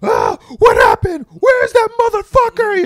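yelling for his killer; furious roar. Retold or imitated. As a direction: roar it like a man just back from the dead. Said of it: "He was just like".

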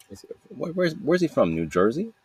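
high, questioning mimicry of a stranger; puzzled listener. Imagined listener guessing where the accent is from, puzzled. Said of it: "it's freaking weird the way I talk".

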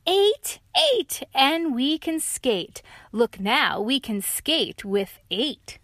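high excited squeal then slow; thrilled to skate; excited high dog; gleeful dog. Acted.

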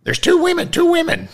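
very high, squawking parrot voice. Acted. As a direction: screech it like an excited parrot. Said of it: "the parrot squawked".

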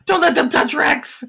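very high, loud gargoyle plea; begging gargoyle. Acted. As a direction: cry out not to be touched. Rex begging again.

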